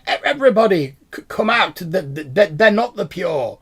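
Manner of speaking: high excited shout to the huts; stammering excited call. Acted. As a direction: call everyone out of hiding, loud and high. Villager calls out to hut.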